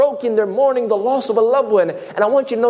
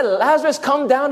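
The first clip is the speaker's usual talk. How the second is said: raised-pitch quoted call; mock summons; 7 semitones higher than usual. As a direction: echo the call incredulously.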